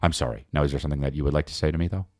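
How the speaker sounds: passive-aggressive apology. Shown as a demonstration. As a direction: rush the apology into a demand. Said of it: "That's me when I apologize".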